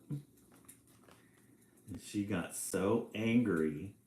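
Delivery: ordinary delivery, nothing marked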